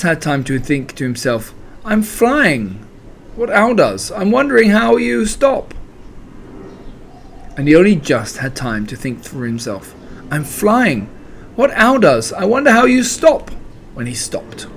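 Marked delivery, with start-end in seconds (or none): 1.8-5.7 high, airborne Pooh; startled falling thought. A narrator's reading. Pooh thinking to himself as he falls, startled. 10.3-13.5 rising to a high pitch; startled falling thought. A narrator's reading. Pooh thinking to himself mid-fall, startled.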